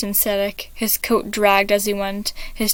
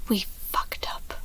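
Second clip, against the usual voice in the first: much softer, hushed confession; mumbled whisper; 11 dB quieter than usual. Acted. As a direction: whisper it in shame. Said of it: "Roman mumbled".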